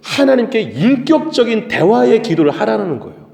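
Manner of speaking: high, forceful sermon delivery; emphatic preaching. Real, not acted. Preacher urging congregation to pray personally to God, emphatic. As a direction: drive each point home, stretching key words.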